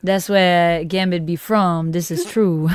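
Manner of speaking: slow, drawled New Orleans Cajun accent; hammed-up Cajun, breaking into a laugh at the end; Gambit. Shown as a demonstration. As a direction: put on a playful Cajun drawl. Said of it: "It's hard to do a Cajun accent".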